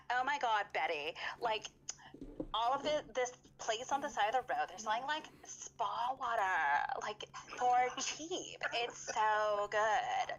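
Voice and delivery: put-on breathy, airy valley girl gush; gushing like a valley girl about cheap drinks. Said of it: "Valley girl".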